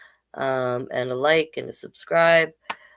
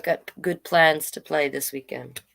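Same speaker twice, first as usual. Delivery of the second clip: usual speaking voice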